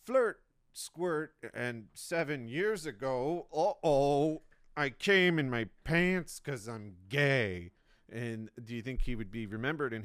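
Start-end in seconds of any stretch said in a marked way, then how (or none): 0.1-7.7 loud, slow mock speech. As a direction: bellow it like a botched speech. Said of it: "and he said".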